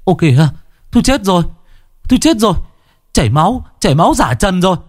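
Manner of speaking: high-pitched, alarmed exclamations; panicked cry. A narrator's reading. Man cries out on seeing the corpse bleed, panicked. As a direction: call out in horror, pitch jumping high. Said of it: "hốt hoảng kêu lên".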